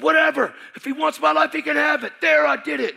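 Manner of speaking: loud, high furious yell; yelling his surrender at the pastor; angry shout. Retold or imitated. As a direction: spit it out in defiance. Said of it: "And I'm angry".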